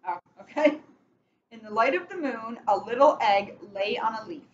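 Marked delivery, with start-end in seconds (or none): 1.5-4.4 slow storybook reading with steady rhythm; picture-book read-aloud, measured and clear; reading to children. Acted. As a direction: read it like bedtime story time for kids. Reads picture book to kids.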